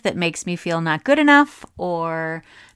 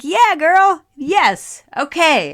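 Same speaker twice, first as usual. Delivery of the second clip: high, loud, emphatic agreement; fired-up cheering; 10 semitones higher, 7 dB louder, slower than usual. Retold or imitated. Reacting to an inspiring woman's videos, fired-up cheering. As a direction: cheer back as if pumped up. Acts out her fired-up response.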